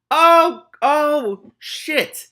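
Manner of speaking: high, loud cry of dismay; shocked outburst. Real, not acted. Reader reacting to the scene, shouting in shock. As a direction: blurt it out in real shock.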